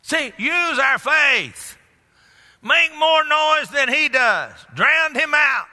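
high, slow, rousing preacher's shout; full-force preaching. Real, not acted. Preacher rallying church against the devil, full-force preaching. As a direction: drive each command home to the congregation.

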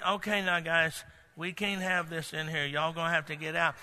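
soft polite mock plea to the money changers; meek, simple voice. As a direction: ask them to leave without any force. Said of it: "real simple voice".

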